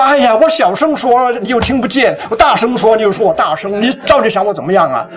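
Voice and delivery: exasperated, high-pitched griping that eases down; mock angry wife. Wife answering husband's complaint about her volume, exasperated. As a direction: imitate a fed-up wife arguing back. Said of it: "她生气了".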